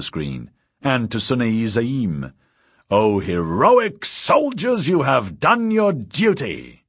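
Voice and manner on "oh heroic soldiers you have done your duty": high, slow, pompous address to soldiers; grand orator voice. A narrator's reading. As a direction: proclaim it slowly like a theatrical hero. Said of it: "he said".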